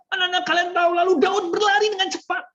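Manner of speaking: quick, high and urgent storytelling; fast-paced narration. Shown as a demonstration. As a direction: rush the line along at a high pitch. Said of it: "dengan mengatur kecepatan kita ngomong".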